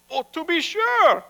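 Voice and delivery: high-pitched, keen agreement; eager assent. Acted. Rabbi assuring God he wants a son, eager. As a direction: answer eagerly, voice jumping up.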